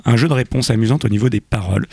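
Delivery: normal talking voice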